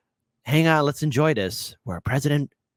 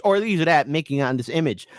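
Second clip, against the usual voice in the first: ordinary talk, nothing marked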